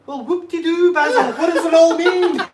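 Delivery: mock the fancy titles in a shrieking silly voice; hammed-up high mocking voice; mocking quote. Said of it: "I couldn't help but go".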